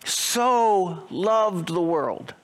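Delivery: "So" held long, whole phrase very slow; slow stress on so. Shown as a demonstration. Pastor repeats the verse to the church, drawn-out "So". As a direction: savour "So loved the world". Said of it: "repeats verse slowly".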